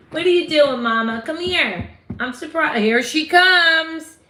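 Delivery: high, loud, delighted calling; excited greeting. Real, not acted. Streamer spots her soulmate coming over, excited greeting.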